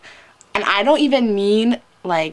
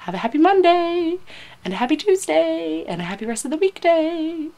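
high, lilting farewell; each phrase swung up high and held; singsong sign-off; 10 semitones higher than usual. Real, not acted. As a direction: chirp the wishes in a high singsong. Playful sing-out sign-off to viewers.

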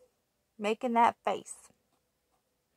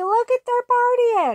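high and loud, delighted at the party; excited; 12 semitones higher, 5 dB louder than usual. Acted. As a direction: gush over the partying mice. Excited reading to child.

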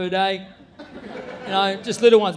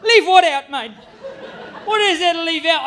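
baffled retort; 6 semitones higher, 5 dB louder than usual. Retold or imitated. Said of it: "I'm like".

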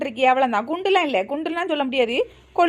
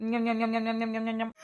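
soft, rapid nom-nom munching; munching noise; 4 semitones lower, 10 dB quieter than usual. Acted. Auntie eating laddus while others sleep, munching. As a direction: gobble away under your breath.

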